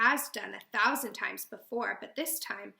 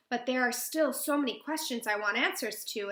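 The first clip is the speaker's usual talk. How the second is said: plain reading voice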